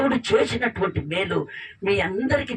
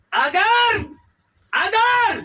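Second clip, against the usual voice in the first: higher, louder call of a name; 10 semitones higher, 4 dB louder, much slower than usual. Acted. Angel calling to weeping Hagar, loud summoning call. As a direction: call her name across the forest.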